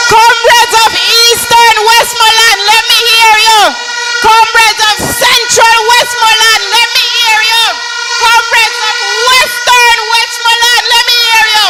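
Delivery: loud rally call to the crowd. Real, not acted. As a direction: rev up the crowd, loud and high.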